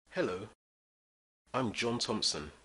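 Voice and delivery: ordinary talk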